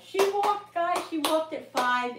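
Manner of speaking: high, loud and slow joyful announcement; triumphant. Real, not acted. Telling viewers her daughter walked, slow joyful high cry. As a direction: land the happy ending proudly.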